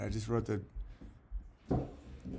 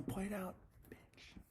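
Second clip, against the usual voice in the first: whispered insult; 7 semitones higher, 19 dB quieter, much slower than usual. Real, not acted. Whispered jab at a chatter.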